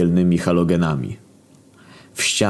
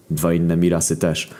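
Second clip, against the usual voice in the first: ordinary delivery, nothing marked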